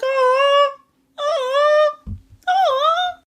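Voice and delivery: very high, squeaky aws, almost crying; touched squealing aw. Retold or imitated. Watching [name] on stage, overwhelmed, high squeaky aws. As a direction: coo like something's melting your heart. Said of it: "I was like".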